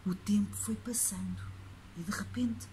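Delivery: hushed suspense whisper. A narrator's reading. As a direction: whisper to build tension. Suspenseful night narration.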